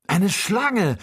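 high, loud shout of discovery; alarmed cry. A narrator's reading. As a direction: cry out the sighting, pitched high. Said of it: "rief Joe". (lauter, hoher Schreckensruf)

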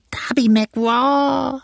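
very high, very slow, drawn-out wail; eerie wailing ghost voice; ghostly whine. A narrator's reading. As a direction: moan it like a lost spirit far away. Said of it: "winselte es".

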